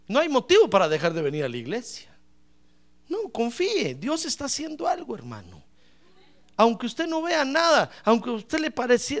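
usual speaking voice

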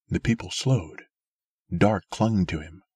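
ordinary delivery

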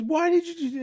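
put-on high, indignant kid voice; daughter, a little angry. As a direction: sound like a cross little girl. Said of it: "she's like, a little angry".

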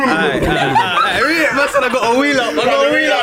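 high, giggly words through laughter; can't stop cracking up; laughing. Real, not acted. Host breaks up laughing.

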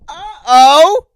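high, loud, startled uh-oh; alarmed uh-oh. Pretend. To his own blood, a high loud startled uh-oh. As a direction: yelp it in sudden alarm.

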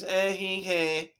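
lightly imitated kid's taunt; mock the kid's inflection; put-on inflection. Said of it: "put the inflection on their voice".

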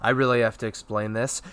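ordinary delivery

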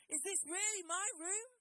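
put-on child's high, loud amazed question; excited child. Children seeing their new room, high excited squeal. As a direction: squeal it like a thrilled kid. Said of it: "The children were like".

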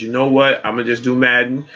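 ordinary talk, nothing marked

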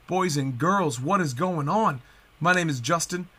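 ordinary talk

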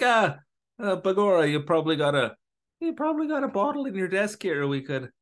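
high Irish cop voice; leprechaun. Retold or imitated. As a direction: put on a high, hammy Irish brogue. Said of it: "It was kind of a funny scene".

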